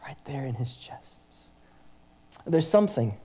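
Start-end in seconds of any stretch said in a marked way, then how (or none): 0.1-1.3 whisper. Real, not acted. Intimate image of God's embrace.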